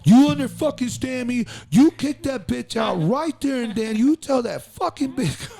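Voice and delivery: high, slow, hammed-up furious gangster; mock-furious mentor laying down the law; gangster rant. Sonny ordering the kid to kick her out, hammed-up rant. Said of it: "he's dead ass serious".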